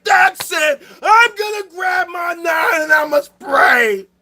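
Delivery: mock rage yell, strained and crying out; mocking himself furious. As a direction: parody a hothead snapping and threatening, slow and over the top. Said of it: "Y'all see me being like".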